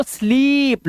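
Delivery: स्लीप with a long, held "eee"; long vowel. Shown as a demonstration. Teacher correcting a pupil's word, long vowel. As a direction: slowly stretch the vowel in स्लीप. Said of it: "long vowel sound".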